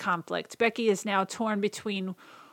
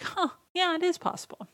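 lightly voiced little kid, pitched up; high little kid voice; 9 semitones higher than usual. Little Tad agreeing that it is possible, in a lightly voiced high kid voice. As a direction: chirp it like a small boy agreeing.

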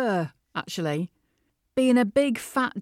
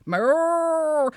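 cow moo; 6 semitones higher than usual. Acted. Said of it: "adding a few moos".

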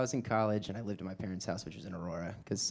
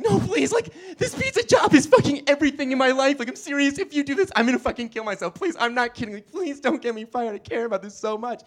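much higher, louder hammed-up pleading; overacted begging for his pizza job; fake desperation; 7 dB louder than usual.

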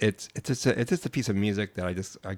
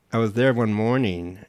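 normal talking voice

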